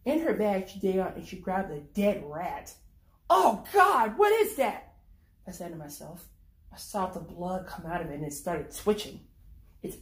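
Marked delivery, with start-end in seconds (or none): 3.3-4.9 high, loud horrified gasp of words; shocked cry. Acted. Narrator recoiling at the dead rat in her bag, horrified.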